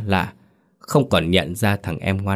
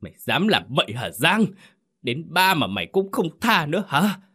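climbing much higher in rage; furious outburst; 9 semitones higher than usual. Acted. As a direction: rage at his brother for desecrating father's grave. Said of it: "thở hồng hộc vì cơn giận mà rít lên".

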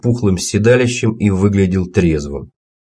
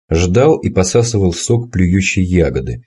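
usual speaking voice

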